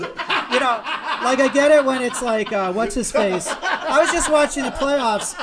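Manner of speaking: high, loud, laughing rant; incredulous laughing tirade. Real, not acted. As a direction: rant about him, cracking up. Ranting about out of shape guy.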